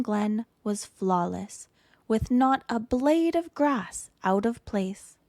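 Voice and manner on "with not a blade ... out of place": ordinary delivery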